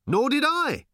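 high, quick-fire shouted retort; shouting back. A narrator's reading. Arthur agreeing with Ford mid-gallop, shouting back. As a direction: throw the reply back high. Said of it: "shouted Arthur back".

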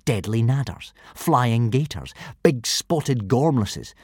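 ordinary delivery

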